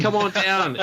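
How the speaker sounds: high, hammed-up ad pitch; tourism advert voice. Playing a walking Australian tourism advert, hammed-up ad pitch. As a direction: sell it like a cheesy tourism ad. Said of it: "talking Australian tourism advert".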